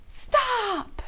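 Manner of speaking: very high, piercing shout; big yell. Acted. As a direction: scream it to stop the noise. Baby Peter's big yell.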